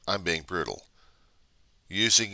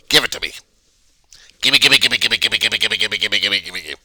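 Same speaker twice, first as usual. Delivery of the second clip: greedy demanding brat; 2 semitones higher, 8 dB louder than usual. Acted. Vlogger playing selfish American demanding everything. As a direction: whine it like a grabbing child.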